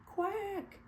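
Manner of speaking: high startled quack; high falling duck quack. A narrator's reading. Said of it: "went Missus Mallard".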